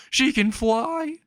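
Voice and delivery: very high, drawn-out squeak of a question; shocked disbelief. Acted. Mother seeing a flying lady, shocked. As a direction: stretch it out high in mock shock.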